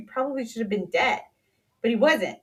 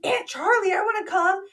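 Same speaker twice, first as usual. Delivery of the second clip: excited little daughter; 10 semitones higher, 5 dB louder than usual. Retold or imitated. Daughter voiced eagerly in story.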